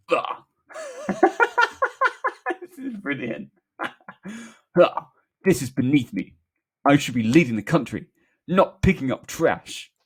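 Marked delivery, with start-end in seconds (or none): 0.1-0.4 loud, grumbled groan; hammed-up grumble; grumbling Boris. A narrator's reading. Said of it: "Boris grumbling". 4.8-9.9 loud, indignant politician impression; hammed-up, affronted bluster; grumbling Boris. A narrator's reading. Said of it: "Boris grumbling".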